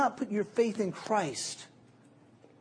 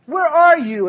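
much higher and louder; demanding shout; 11 semitones higher, 14 dB louder, slower than usual. Retold or imitated. Girl calling her late date, loud and demanding. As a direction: snap it down the phone. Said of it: "So you call him".

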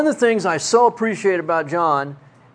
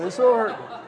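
playfully wounded, laughing; mock hurt; 4 semitones higher than usual. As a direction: pretend to be offended. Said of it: "everybody laughs at me all the time".

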